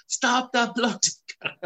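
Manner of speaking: high, strained shout through the glass; desperate yell. Retold or imitated. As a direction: bellow at parents who can't hear. Banging on caravan window, unheard.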